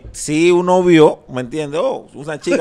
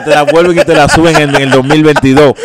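emphatic rant; 12 dB louder than usual. Real, not acted. Animated complaint about gringos.

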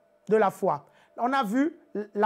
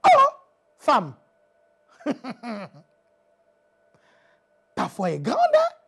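Jesus amazed; 5 dB louder than usual. Shown as a demonstration. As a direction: let Jesus marvel at her. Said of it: "Non, moi je".

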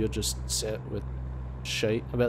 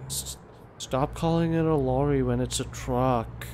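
slow, drawn-out American impersonation; exaggerated American complainer; mock American accent; 3 semitones higher, slower than usual. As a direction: mimic a whinging American, dragging the words. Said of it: "it was probably an American".

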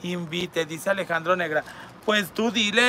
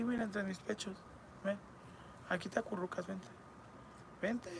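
much softer, cooing invitation; mock seductive coaxing; 14 dB quieter than usual. Coaxing viewer to cuddle. (susurro coqueto de burla)

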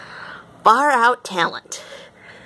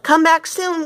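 calling out; 9 semitones higher than usual. A narrator's reading. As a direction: call out to the leaving ship. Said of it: "called Kermit".

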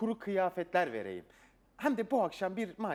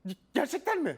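excited disbelief; 8 semitones higher, 4 dB louder than usual. Acted. Wish granted.

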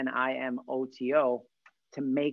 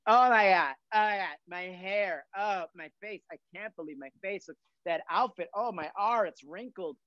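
mock panic; 5 semitones higher than usual. Acting out panicked self-critique.

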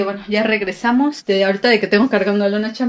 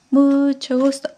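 put-on baby talk; 6 semitones higher, 4 dB louder, slower than usual. Mom greeting baby on camera.

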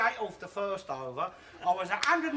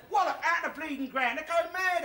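ordinary delivery

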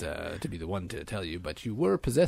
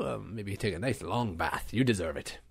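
ordinary talk, nothing marked

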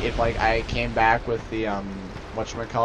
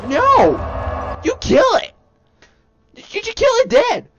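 excited shout; slower than usual. Real, not acted. Gamer ranting at the screen about rat armies, shouting. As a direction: yell it like the only sane choice.

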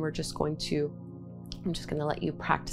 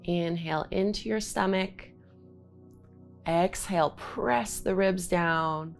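guided breathing cues. Acted. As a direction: call out each inhale and exhale.

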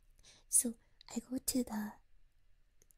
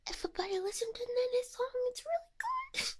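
squeaky high manager voice; high-pitched imitation; 12 semitones higher than usual. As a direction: pitch way up to play the boss. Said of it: "she was like".

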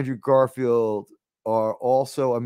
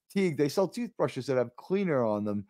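usual speaking voice

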